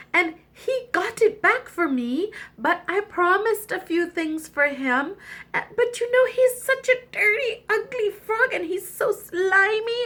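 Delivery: high, pouting complaint to daddy; whiny princess. Acted. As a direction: sulk and whine in a high voice. Princess whines to the king.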